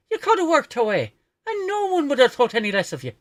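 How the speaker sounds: high, loud comic Irish voice; Irish accent. Acted. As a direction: exclaim in a hammed-up Irish accent. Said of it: "Whenever I do an Irish accent".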